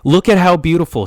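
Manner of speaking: mock shouted caption; mock yell. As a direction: belt it out like shouting caps lock. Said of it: "in all caps, so he's, like, yelling".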